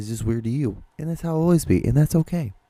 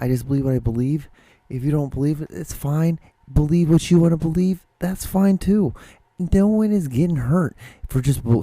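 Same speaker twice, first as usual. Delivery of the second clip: rising higher and louder, airy dismissive tone; voicing own inner thought; 8 semitones higher, 3 dB louder than usual. Telling believers to believe what they want, higher and louder. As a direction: brush it off like you don't care what they believe. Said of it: "I'm like".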